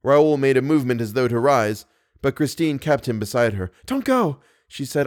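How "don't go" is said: put-on high-pitched, pleading young woman; higher, anxious heroine voice; urgent plea. A narrator's reading. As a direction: plead with him to stay. Christine pleads with Raoul to stay.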